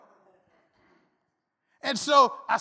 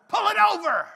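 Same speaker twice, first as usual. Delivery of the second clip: hammed-up tough-guy shout; 9 semitones higher, 8 dB louder than usual. Mock tough-guy rant.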